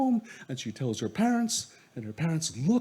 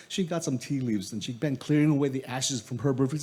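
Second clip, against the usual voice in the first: ordinary delivery, nothing marked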